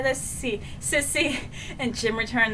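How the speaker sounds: ordinary talk, nothing marked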